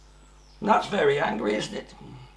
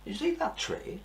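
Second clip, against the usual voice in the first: plain reading voice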